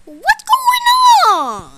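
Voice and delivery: slow, high, loud cry of shock; shocked shout. Acted. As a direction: drag it out in alarm. Shocked figure.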